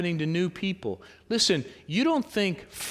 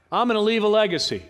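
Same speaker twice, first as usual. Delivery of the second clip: cocky boast; 5 semitones higher, 5 dB louder, faster than usual. Acted. Voicing a boastful listener.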